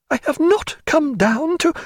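high voice holding back tears, broken off; mastering tears. A narrator's reading. Diana keeping her pride as tears start, struggling for control. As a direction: fight down tears, stress NOT, then break off. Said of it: "She mastered it and said".

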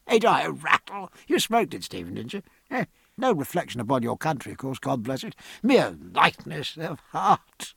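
merry, chatty captain after a laugh; amused captain. Acted. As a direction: babble on, tickled by your joke.

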